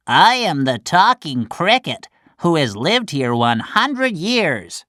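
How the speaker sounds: high, wise little creature; old cricket voice. Acted. The talking cricket introducing itself to Pinocchio, high creature voice. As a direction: speak like an ancient insect.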